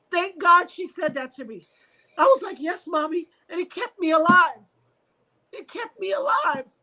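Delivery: high, choked-up gratitude; tearful gratitude. Real, not acted. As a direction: voice lifting as tears come. Tearful recollection of mother's words.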